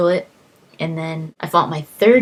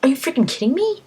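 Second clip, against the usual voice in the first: higher, quick, disbelieving outburst; exasperated; 9 semitones higher, faster than usual. Retold or imitated. Gamer fed up with the regenerating troll, quick exasperated outburst. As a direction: fire it off in annoyed disbelief. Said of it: "So I was like".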